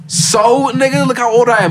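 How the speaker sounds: loud, high protest; exasperated protest. Retold or imitated. To his dad over swearing, a loud exasperated protest. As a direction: push back at him hard.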